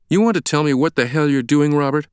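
high, indignant confrontation; angry demand. A narrator's reading. Stranger confronts Langdon in the baptistry, demanding. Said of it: "he demanded".

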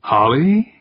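a mild startled yelp; surprised yelp. A narrator's reading. Incumbent spotting his unexpected rival, yelping. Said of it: "he yelped".

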